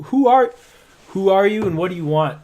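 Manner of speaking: wary sentry shouting down; guard challenge. Acted. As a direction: call down to the stranger demanding answers.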